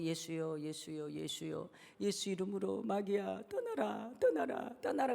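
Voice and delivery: mock a scared believer praying meekly; timid trembling prayer. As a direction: softly imitate a fearful, feeble command. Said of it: "벌벌벌 떨면서". (벌벌 떨며 기어드는 소리로)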